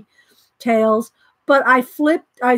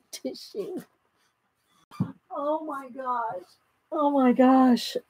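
much softer, slow, spent; exhausted after laughing; 8 dB quieter, slower than usual. Real, not acted. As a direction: wind down from a laughing fit. Recovering after laughing fit.

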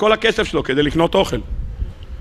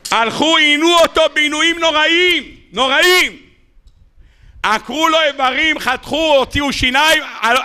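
fiery sermon; 7 semitones higher than usual. Acted. Preacher rousing the congregation with a torture story, impassioned. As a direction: paint the horror at full force.

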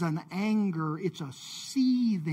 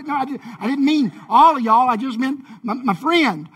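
loud, raised, comic backtracking; flustered correcting; 5 semitones higher, 10 dB louder than usual. Pretend. Jesus explaining himself to the whole graveyard, comic fluster. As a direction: take it back loudly in mock fluster. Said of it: "he'd have to send them back".

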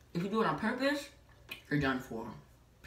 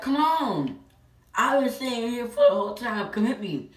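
loud, higher, daring challenge; taunting shout; 5 semitones higher, 7 dB louder than usual. Retold or imitated. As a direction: yell at him to come and hit you. Daring the boy to fight.

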